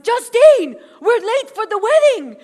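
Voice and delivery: loud, high-pitched hurry-up call; exasperated parent calling out; dad's impatient shout. Retold or imitated. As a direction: imitate a dad yelling to get a move on. Dad hurrying her, acted.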